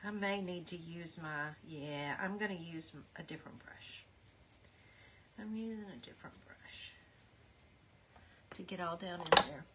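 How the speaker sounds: very soft, near-whispered murmur; hushed working-to-yourself voice; muttering to self. Real, not acted. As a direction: think aloud under your breath.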